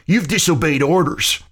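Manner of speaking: high-pitched, mocking jab; snickering taunt. A narrator's reading. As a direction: needle him with a snicker in the voice. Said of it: "McCreary snickered".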